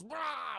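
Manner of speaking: high-pitched mock screech; brief comic roar; imitated monster roar. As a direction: let out a short kaiju cry.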